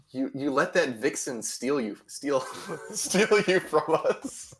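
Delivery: laughing tease. Real, not acted. Teasing cohost about leaving them.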